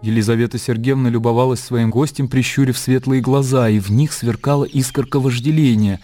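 ordinary delivery, nothing marked

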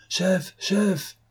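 high, urgent call; alarmed calling out. A narrator's reading. Staff running up to the chief, alarmed high call.

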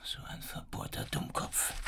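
a hissed aside to no one; exasperated whisper to himself; muttered whisper. Acted. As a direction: whisper it under your breath in disgust. Inspector mutters to himself alone.

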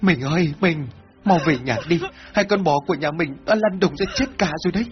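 high, breathless panic; out-of-breath alarmed wife; panicked. A narrator's reading. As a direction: rush the bad news to your husband in a fright. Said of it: "chị ta hốt hoảng mà nói".

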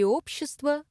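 ordinary delivery

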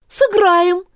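put-on high, eager girl's call; shouted reply. A narrator's reading. Girl answering the queen, high shout. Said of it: "крикнула Алиса".

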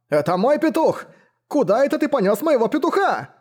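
high outraged yell; angry yell. Acted. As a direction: shriek after the thief. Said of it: "гневные крики". (возмущённый крик)

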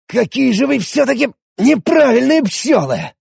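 hoarse. A narrator's reading. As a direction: croak it out hoarsely. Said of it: "прохрипел я".

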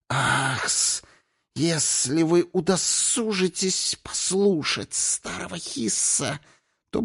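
high, slow serpent voice with drawn-out hissing s's; snake king. Acted. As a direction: hiss out a sly offer, stretching every sibilant. Заговорил змеиный царь Хис. (змеиный царь: высокий тягучий шипящий голос)

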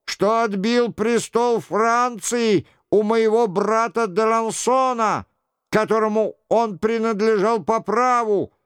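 high-pitched mock-royal confession; sing-song show of repentance; imitating the king. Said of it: "продолжал за Генриха Шико".

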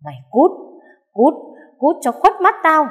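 enraged mistress driving out the maid; furious shout. A narrator's reading. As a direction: scream her out of the house. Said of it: "chỉ tay vào mặt nó quát".

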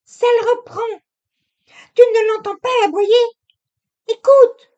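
put-on high, loud, hurried young woman insisting; the wife covering her lie with chatter; young wife, insistent. A narrator's reading. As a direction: press him to listen, too eager. Narrator voices Camille.

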